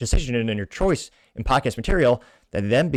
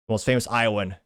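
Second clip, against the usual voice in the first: ordinary talk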